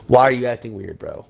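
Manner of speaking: usual speaking voice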